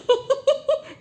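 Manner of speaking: very high, giggling jeer; mocking laugh. Bully laughing at someone's weight, high mocking giggle. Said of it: "कहने का तरीका क्या है".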